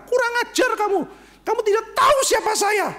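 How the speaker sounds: high, shrill yell of an entitled passenger; angry shout. Acted. Mother on plane scolding flight attendant, shrill shout. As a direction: blast it at the stewardess, outraged.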